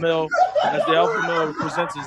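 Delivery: nature documentary. Pretend. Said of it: "doing what they do on the Discovery Channel".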